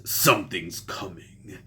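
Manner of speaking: low, quiet, self-admittedly bad Russian accent; a lightly done menacing Russian accent for Doom; Doctor Doom's Russian accent. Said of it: "I do a terrible Russian accent".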